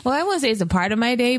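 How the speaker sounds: ordinary talk, nothing marked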